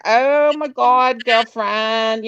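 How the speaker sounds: slow, drawn-out imitated Bronx accent; playful put-on Bronx accent. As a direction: stretch it like a Bronx girlfriend. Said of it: "But we talk like that".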